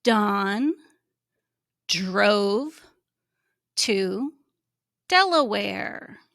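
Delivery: Don drove to Delaware read slowly with a pause after each word; measured read-aloud. Shown as a demonstration. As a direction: model reading one word at a time. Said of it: "follow with me".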